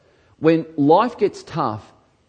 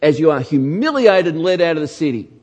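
loud, urgent pulpit warning; preaching at full force; 6 semitones higher, 8 dB louder than usual. Acted. Preacher voicing Jeremiah, loud and urgent. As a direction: thunder the prophecy at the congregation.

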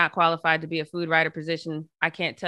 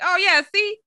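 high, louder, eager; excited; 6 dB louder than usual. Retold or imitated. Showing the owner the picture, eager and high. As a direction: show it off eagerly. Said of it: "I'm like".

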